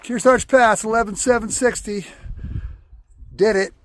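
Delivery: high, loud summit shout; excited. Real, not acted. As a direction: call it out in triumph. Reaching the second pass.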